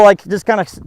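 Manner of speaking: ordinary delivery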